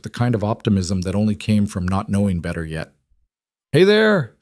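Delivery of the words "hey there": loud, high hail of "Hey there!"; calling out. Acted. Prospector calling to Daniel across the woods, loud friendly hail. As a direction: hail a stranger across the trees. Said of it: "the taller one called out".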